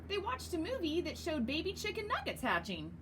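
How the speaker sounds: usual speaking voice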